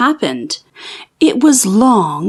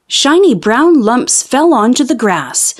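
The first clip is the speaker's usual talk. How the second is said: usual speaking voice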